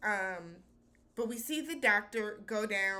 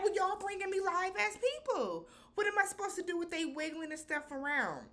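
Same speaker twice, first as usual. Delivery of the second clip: high-pitched mock whine with a crying edge; whiny doctor excuse; 9 semitones higher than usual. Doctor whining back at the teasing divers, high mock whine. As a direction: whimper the excuse like a put-upon doctor. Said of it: "And he's like".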